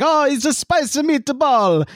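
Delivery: high, slow, hammed-up Italian accent; mock Italian accent. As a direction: draw it out in a cartoon Italian accent. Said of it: "Why did you have to do the accent".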